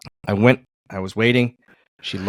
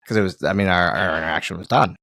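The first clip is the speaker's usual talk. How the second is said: ordinary delivery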